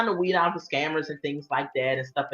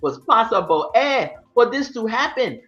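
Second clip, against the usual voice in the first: imitated African accent, playfully put on; hammed-up African accent for a retold complaint; 2 semitones higher than usual. Mimicking a deported reality star, playful African accent. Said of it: "I love doing my African accent".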